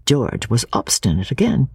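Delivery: usual speaking voice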